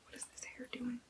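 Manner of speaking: breathy unvoiced muttering; whispered aside. Real, not acted. Booktuber fussing with her hair mid-reading, whispering. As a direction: whisper it off to the side.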